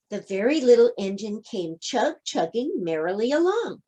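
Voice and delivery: ordinary delivery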